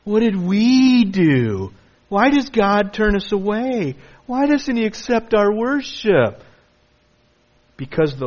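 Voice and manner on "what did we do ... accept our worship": higher, slow protesting whine; mock indignant questions. Preacher voicing worshippers protesting to God, higher. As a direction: act out the offended worshippers. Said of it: "And you say".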